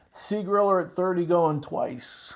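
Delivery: slow, high, loud bid call; auctioneer's countdown. Real, not acted. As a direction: call it out to the bidders, stretching the words.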